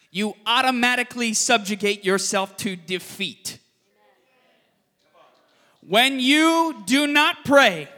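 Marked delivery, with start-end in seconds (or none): 5.8-7.9 very slow and louder, each word weighed; slow emphatic. Shown as a demonstration. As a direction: hammer the point home slowly. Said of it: "repeated slowly for emphasis".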